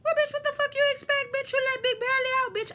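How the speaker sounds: high, loud, fast retold rant; hammed-up scolding of the friend; mock furious outburst.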